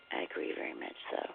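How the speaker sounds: hushed, gravelly and spent after no sleep; very soft, voice dropping to a rough whisper. Real, not acted. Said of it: "my voice is kinda gravelly".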